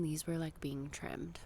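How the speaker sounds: ordinary delivery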